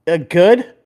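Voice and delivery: deadpan a puzzled reply to a dumb question; Willie B. Retold or imitated. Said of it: "he looks at me, he's like".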